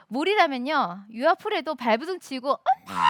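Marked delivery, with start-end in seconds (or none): 2.5-3.0 훨씬 높고 겁먹은 아이 외침; 물에 빠질까 엄마를 부르는 아이처럼; child crying for mom. Retold or imitated. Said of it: "하는 그런 아이였는데". (겁먹은 아이의 엄마 외침)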